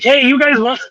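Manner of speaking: high, cheeky offer; mischievous offer. Retold or imitated. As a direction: play the grinning buddy offering loot. Buddy offering stolen pole.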